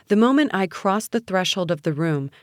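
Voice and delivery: usual speaking voice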